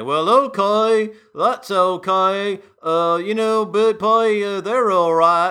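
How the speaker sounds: slow, loud, high hammed-up American drawl; mock American accent. Host mocking the public's reaction to BP's new American CEO, in a hammed-up American accent. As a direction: play the easygoing American shrugging it off in a mock accent. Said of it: "delivered with an American accent".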